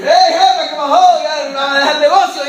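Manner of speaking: lightly voiced driver, teasing and quick; chauffeur's voice. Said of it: "el chofer te vea llorando y dice". (chofer burlón, rápido y más fuerte)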